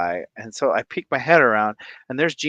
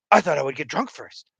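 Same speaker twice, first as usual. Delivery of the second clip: higher, fast, panicky rush; panicking brother; 5 semitones higher, 4 dB louder, faster than usual. Retold or imitated. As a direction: blurt it out in a rising panic. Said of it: "looks at me and goes".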